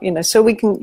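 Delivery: ordinary talk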